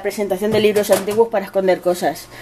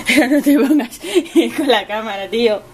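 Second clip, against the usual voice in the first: laughing while talking; a real laugh through the words; 2 semitones higher than usual. Real, not acted. Laughing at the cat.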